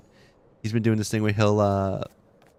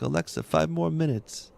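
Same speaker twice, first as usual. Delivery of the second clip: lightly voiced kid, higher pitched; a hint of a kid bargaining with Alexa; retold child; 7 semitones higher than usual. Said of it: "He'll be like".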